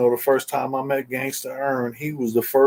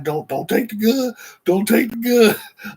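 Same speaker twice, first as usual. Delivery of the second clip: higher, urgent pleading; scared friend pleading from the back seat, higher; anxious plea; 8 semitones higher than usual. Retold or imitated. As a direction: beg him not to take it, pitched up.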